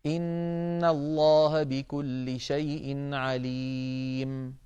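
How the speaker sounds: slow chanted recitation ending in a complete stop; full stop at the verse end. Shown as a demonstration. Teacher demonstrating a full stop at Al-Anfal's end, slow chant. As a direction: recite slowly and stop fully on عليم. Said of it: "أن نقول".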